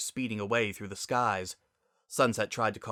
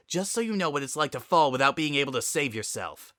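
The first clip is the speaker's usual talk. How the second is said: louder, hard threat, pressing on "lying"; menacing; 5 semitones higher, 4 dB louder than usual. Acted. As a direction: lean in and threaten her. Spitfire threatens her sister.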